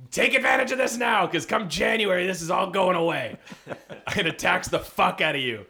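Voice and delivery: usual speaking voice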